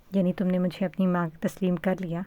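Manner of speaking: ordinary delivery, nothing marked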